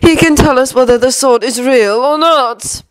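Lucius. A narrator's reading. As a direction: stretch the words out menacingly. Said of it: "came Lucius's voice".

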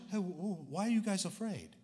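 a hushed, halting Jesus asking why they fear. Retold or imitated. Preacher imagining Jesus waking up groggy, a soft stammered question. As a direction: drop the voice and stumble over the why. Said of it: "he didn't ask the question".

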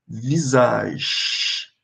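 visage dito devagar, sem o E final; visage without final E. Shown as a demonstration. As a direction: pronuncie visage sem o E do fim. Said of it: "Observe que eu não tô pronunciando o E do fim". (visage sem pronunciar o E final)

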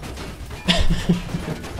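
silent wheezing laugh; unvoiced chuckle at himself; breathy laugh. Real, not acted. Laughs after falling.

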